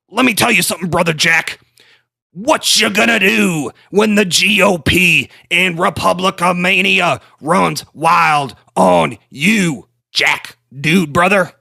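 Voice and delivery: high, loud, slow hammed-up wrestler promo; bad Hulk Hogan voice, playing it up; Hulk Hogan impression. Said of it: "the worst Hulk Hogan impression".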